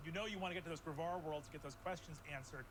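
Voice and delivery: building storytelling narration; game master scene setting. Acted. GM sets the new scene.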